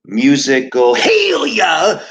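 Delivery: hammed-up revival preacher calling out healing; preacher's healing cry. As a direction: cry out like a faith healer at the pulpit. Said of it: "The Reverend Peace is about to broadcast".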